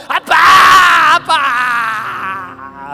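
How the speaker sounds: imitating a long, drawn-out shout; hammed-up wail to Daddy in prayer; elders crying out in prayer. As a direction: stretch the word out at full voice. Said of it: "장로님들이 금철 때 나와서 기도하셨어요".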